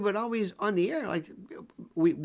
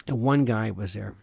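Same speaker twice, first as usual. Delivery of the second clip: ordinary talk, nothing marked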